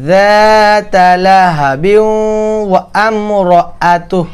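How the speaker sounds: slow chanted যাতা লাহাবি ওয়া আমরাতুহ, all linked; joined recitation. Shown as a demonstration. Teacher showing how to join the verse. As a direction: recite it all in one breath, slowly. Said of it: "কেমনে বলি?".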